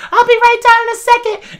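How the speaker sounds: high sing-song call; mock teen girl calling out. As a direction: call down from upstairs, bright and high.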